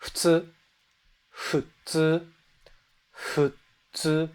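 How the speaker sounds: futsu with a soft blend of H and F; Japanese futsu. Shown as a demonstration. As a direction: breathe the F of futsu, halfway to an H. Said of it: "You're kind of combining H and F".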